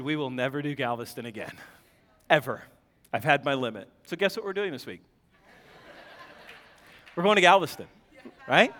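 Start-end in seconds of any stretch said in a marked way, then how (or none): 0.0-3.9 much lower and softer, a little fast; grim quiet vow. Retold or imitated. Husband swearing off Galveston to his wife, low grim vow. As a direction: say it flat and final under your breath.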